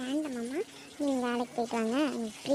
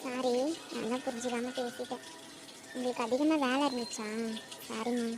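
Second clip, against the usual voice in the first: soft apology; 7 dB quieter than usual. Acted. Wife softens and apologizes.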